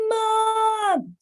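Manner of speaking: put-on high, far-off kid's yell from outside; child yelling Mom. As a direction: shout it like a kid calling from the yard. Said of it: "I heard somebody yell".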